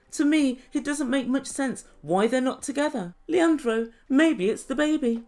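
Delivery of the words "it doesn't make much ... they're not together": plain reading voice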